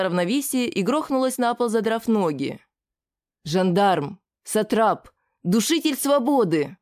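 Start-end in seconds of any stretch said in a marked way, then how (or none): none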